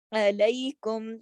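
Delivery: علیکم with 'ai' as in کئی; ya leen. Shown as a demonstration. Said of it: "اس کو علیکم نہیں پڑھتے". (علیکم میں یا لین، کئی کی طرح)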